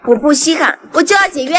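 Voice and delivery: loud, forceful refusal; heated and loud, digging in; defiant anger. Acted. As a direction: throw the offer back in their face. Artist defiantly rejects manager's offer.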